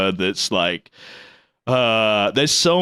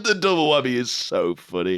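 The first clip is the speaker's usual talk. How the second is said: usual speaking voice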